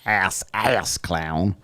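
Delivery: "Ass, ass clown" stretched very slow, savouring each word; drawn-out demo. Shown as a demonstration. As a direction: spell out "ass clown" slowly like teaching the phrase. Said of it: "So people say that in, in English as well".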